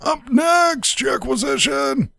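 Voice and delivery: high, hollered segment call; announcer call. Pretend. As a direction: holler the next name, stretching it out. Calls out the next mailer.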